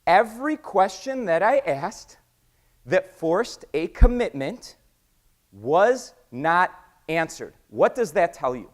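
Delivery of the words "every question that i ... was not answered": slow, weighty "Every question that I asked"; emphatic repeat. Real, not acted. Repeating the key point for the audience, slow emphatic stress. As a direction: pause before "was not answered" and space out each word. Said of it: "So I'm gonna repeat that".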